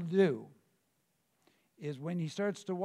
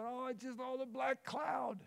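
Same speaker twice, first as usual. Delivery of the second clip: a higher, mocking moan about the gloom; 6 semitones higher, 5 dB quieter than usual. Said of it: "you start and you go".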